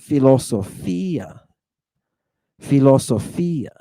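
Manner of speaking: "Philosophia" as fee-lo-so-FEE-ah, stressed on FEE; accent on iota. Shown as a demonstration. Teacher repeating for students, "Philosophia" with stressed, lengthened FEE. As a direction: lean on the FEE in Greek "Philosophia". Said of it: "we put the accent over the iota".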